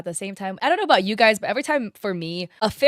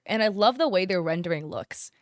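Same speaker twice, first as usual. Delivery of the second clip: ordinary talk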